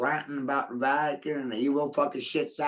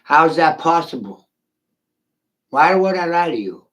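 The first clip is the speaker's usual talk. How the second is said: defiant outburst; 4 semitones higher, 7 dB louder than usual. Real, not acted. Defiant rant to Monica.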